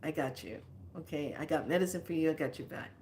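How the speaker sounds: soft, low reassurance; reassuring. Retold or imitated. To the therapist, soft reassuring. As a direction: comfort her gently. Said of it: "I said".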